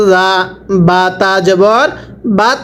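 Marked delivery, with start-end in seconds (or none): none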